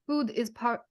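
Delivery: bad, lightly put-on Armenian accent; weak Armenian accent that drifts Russian. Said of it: "I'm really doing a bad job of the accent".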